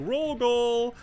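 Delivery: high, loud cheery mock announcer call; imitating fishing lady. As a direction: belt out "Roll goal!" like a game character. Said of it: "she goes like".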